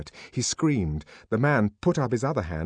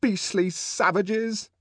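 high, slow scornful exclamation; drawn-out indignant contempt; angry; 11 semitones higher, much slower than usual. A narrator's reading. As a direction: spit out the insult, stretching it. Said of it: "And then she got angry too".

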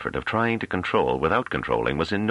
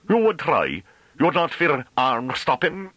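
very high, drawn-out country taunt; menacing rustic farmer; 12 semitones higher, 3 dB louder, slower than usual. A narrator's reading. Farmer threatening to block the scholar's way, taunting. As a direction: dare him in broad dialect.

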